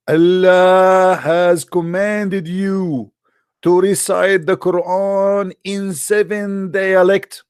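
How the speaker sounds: slow, drawn-out solemn recital; slow mock-solemn recital of a quote. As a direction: intone it slowly like a revelation. Quoting the angel's words solemnly.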